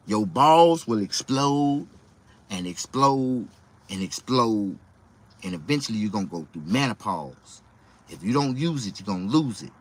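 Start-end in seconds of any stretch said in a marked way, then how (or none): 0.1-4.8 slow warning. Retold or imitated. Comic doctor warning, drawn out.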